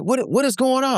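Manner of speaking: high, alarmed parent voice; panicked parent. Retold or imitated. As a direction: squeak it out like a worried mom. Nervous parents asking what's wrong.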